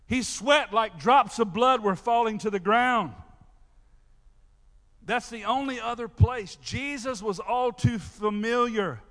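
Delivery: loud, ringing preacher's delivery; preaching at full force. Acted. Pastor preaching to his church about Gethsemane, loud and ringing.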